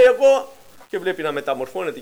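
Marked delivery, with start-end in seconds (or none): 0.0-0.5 high, loud calling out; ecstatic shout. Retold or imitated. Brother realizing the rapture, shouting high to the Lord. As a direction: call to the Lord with joy. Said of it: "άρχισε και φώναζε".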